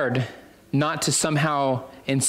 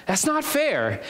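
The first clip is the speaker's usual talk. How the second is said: high-pitched mock complaint; childish protest; 9 semitones higher than usual. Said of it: "they would obviously say".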